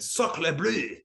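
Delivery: mock French accent. Friends mocking movie French, hammed-up French accent. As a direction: ham up a cartoon Frenchman. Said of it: "then Sammy went".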